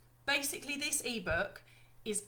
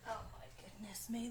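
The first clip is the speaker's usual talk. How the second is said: whisper; 11 dB quieter than usual. Real, not acted. Muttering to herself, lights.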